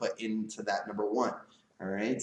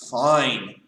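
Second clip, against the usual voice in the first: FINE dropped short and louder, falling, fed up; curt fine; 6 dB louder than usual. Shown as a demonstration. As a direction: snap out Fine like you resent it. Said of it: "there's the difference in tone".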